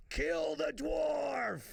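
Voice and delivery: long, high, drawn-out yell; yelled heckle. Retold or imitated. Guy yelling from a truck window at a comedian, drawn-out yell. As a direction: holler it slowly, stretching each word. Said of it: "leans out the window and yells out".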